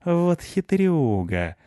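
high, drawn-out outrage; indignant. A narrator's reading. Cat watching the dog charm the family, indignant. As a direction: exclaim slowly, scandalized. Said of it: "возмутился Рыжопиус".

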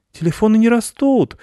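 protesting. A narrator's reading. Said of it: "запротестовал мальчик".

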